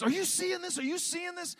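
high-pitched mock yell to friends; urgent, high-voiced imitation of yelling out; imagined yell. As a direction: pitch it way up as if calling out, without full volume. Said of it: "I wanted to yell out".

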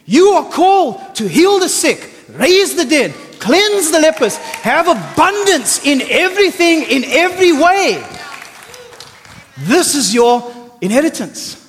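loud, high, impassioned preaching; fired-up sermon voice; full-force preaching. Acted. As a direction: preach it out to the crowd.

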